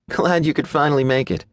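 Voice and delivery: put-on high, snarky girlfriend voice; snarky young woman. A narrator's reading. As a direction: needle him about being late. Maddie voiced in story.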